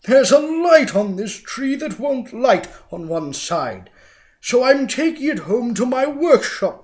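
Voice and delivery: a villain faking kindness; fake sweet Grinch. A narrator's reading. The Grinch lies to the little girl, loud and sugary. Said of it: "the fake Grinchy Claus lied".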